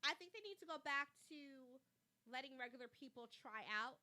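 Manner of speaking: ordinary talk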